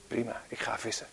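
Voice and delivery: a whispered aside, breathless, as if resigned; mock whisper. Preacher retelling Peter, a mock whisper. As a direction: let Peter whisper it off-handedly. Peter retold, quietly giving up.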